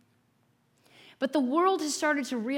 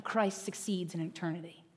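usual speaking voice

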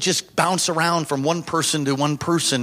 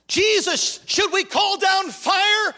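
eager disciples; 12 semitones higher, slower than usual. Retold or imitated. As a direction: beg Jesus for fire from heaven. Disciples ask to burn village.

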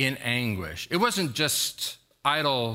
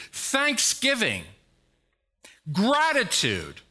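preaching at full force; 10 semitones higher than usual. Acted. Pastor urging the congregation to look to God, fervent high preaching.

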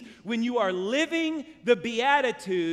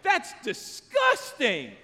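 high, loud mock outrage of a son; hammed-up horrified shout, drawn out at the end; imitated freak-out; 8 semitones higher, 6 dB louder, slower than usual. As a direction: play a kid freaking out at dad. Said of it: "he started freaking out".

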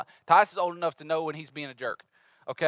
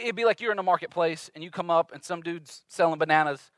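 usual speaking voice

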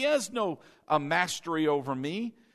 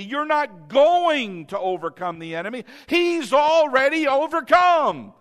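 preaching at full force; 7 semitones higher, 8 dB louder than usual. Acted. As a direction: pound the conviction into the congregation.

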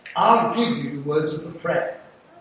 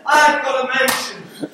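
bursts out laughing, higher and louder; laughing boast; 8 semitones higher, 7 dB louder than usual. Real, not acted. Preacher laughing to the congregation about his mansion, laughing. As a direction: laugh it out with joy over heaven.

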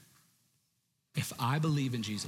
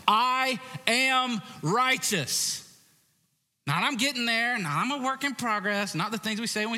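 preaching at full shout, much higher; yelling; 10 semitones higher, 6 dB louder than usual. Real, not acted. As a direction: yell it at the crowd with passion. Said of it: "Sorry for yelling at you".

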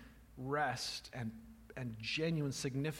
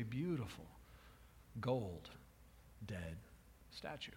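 slowing, dropping softer word by word; hushed reveal; 3 semitones lower, 8 dB quieter than usual. Acted. Preacher revealing the daughter's fate, hushed and slow. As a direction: let the tragedy sink in quietly. Tragic turn of story.

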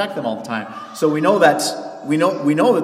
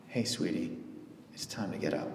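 soft, low and hushed; tender wake-up call; 9 semitones lower, 14 dB quieter than usual. Retold or imitated. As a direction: gently wake a child, much lower and softer. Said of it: "Here's how the Bible writes it".

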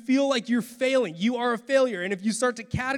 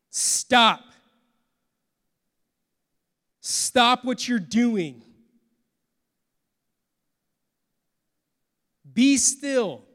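louder, slow and commanding; God firmly telling an overworked person to stop; 2 semitones higher, 5 dB louder, much slower than usual. A narrator's reading. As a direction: stop them in their tracks, firmly. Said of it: "in a firm voice".

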